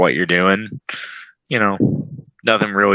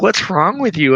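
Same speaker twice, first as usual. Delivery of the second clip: high mock-incredulous question; lightly imitating a baffled friend; Jess asking; 11 semitones higher than usual. Retelling Jess's reaction, high teasing question. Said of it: "She's like".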